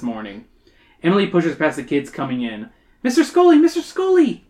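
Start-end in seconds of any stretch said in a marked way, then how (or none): 3.0-4.4 high-pitched yell for attention. Acted. Emily pushing into the lab, yelling to her teacher. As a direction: call out loud and very high. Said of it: "she yells".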